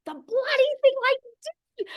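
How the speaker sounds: high, half-crying wail; mock wail. Told the video wasn't linked, she wails in half-crying frustration. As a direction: wail it like you're about to cry. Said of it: "I'm like".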